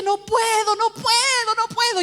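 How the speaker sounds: high, loud, repeated plea; re-enacted sobbing protest. Preacher refusing her calling, re-enacted sobbing protest. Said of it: "ya empecé a sollozar".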